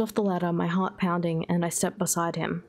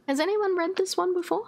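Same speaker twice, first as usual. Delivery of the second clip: pitched up, asking the room; high curious voice; 13 semitones higher than usual. Real, not acted. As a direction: step out of the story, curious. Asks listeners out of reading.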